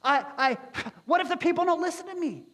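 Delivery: hammed-up anxious whine; whiny Moses. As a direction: complain like a nervous excuse-maker. Moses anxiously pleading excuses.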